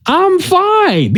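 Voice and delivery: a content friend, lightly voiced; friend's reply. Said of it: "And he was like".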